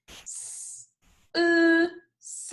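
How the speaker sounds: S, then UH, then both joined; sounds, then blend. Shown as a demonstration. Teacher leading everyone to say it, sound by sound then blended. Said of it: "Everyone say it with me".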